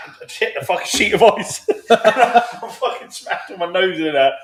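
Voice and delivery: loud, high laughing retelling; cracking up at his own mishap. Real, not acted. Telling his mate about smashing his nose on ice, laughing loudly.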